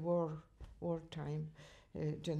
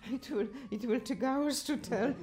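laughing through the words, pitched up; giggly, a little higher; 7 semitones higher than usual. Real, not acted. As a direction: chuckle it out, amused at herself. Laughing about long list.

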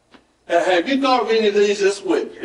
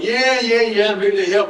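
higher, put-on eager helper; mock willing "yeah, yeah", pitched up; outward eagerness; 4 semitones higher than usual. Preacher mimicking someone on the outside, falsely eager. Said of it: "on the outside you're going".

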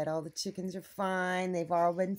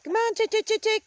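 high, loud chick-chick call; calling chickens; 12 semitones higher, 7 dB louder than usual. Real, not acted. Calling the chickens out of the coop, high and loud. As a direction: sing out to call the hens over.

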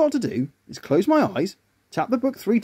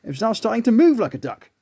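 usual speaking voice

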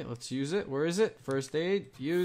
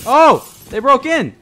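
scared horror game streamer; 11 semitones higher, 15 dB louder than usual. Real, not acted. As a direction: shriek in real panic. Zombies break through window.

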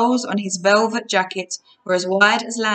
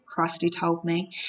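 ordinary delivery, nothing marked

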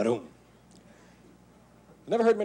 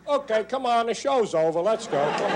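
higher, louder cop dispersing a crowd; New York Irish cop; 10 semitones higher, 5 dB louder than usual. Retold or imitated. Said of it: "Policemen have special phrases".

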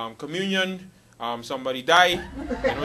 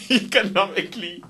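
word said through laughter; laughing; 5 semitones higher, 5 dB louder than usual. Real, not acted. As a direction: laugh while getting the word out, pitched up. Cracks up over his word choice.

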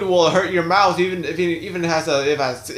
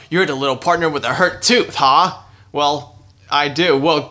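usual speaking voice

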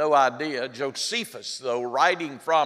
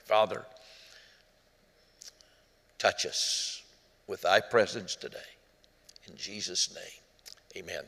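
prayer; 5 semitones lower, 5 dB quieter than usual. Real, not acted. Closing prayer.

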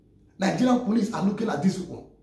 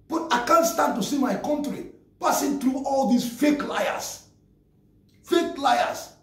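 angry; 8 semitones higher, slower than usual. Real, not acted. Nigerian vlogger angrily denouncing fake activists, heated. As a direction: spit out fake liars in disgust.

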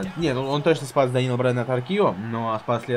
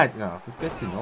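usual speaking voice

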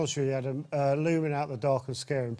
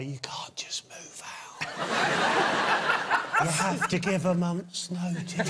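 slow, spooky ghost whisper; ghostly voice; slower than usual. Retold or imitated. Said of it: "in a really ghostly voice".

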